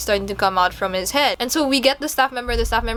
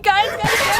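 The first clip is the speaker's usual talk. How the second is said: high, urgent calling of friends; anxious high "guys, guys"; scared whispered-call; 12 semitones higher than usual. Retold or imitated. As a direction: call them in a panic, higher up. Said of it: "and I'm like".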